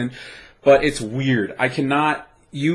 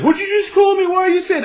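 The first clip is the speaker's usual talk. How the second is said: confused shock; 4 dB louder than usual. Retold or imitated. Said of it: "I was like".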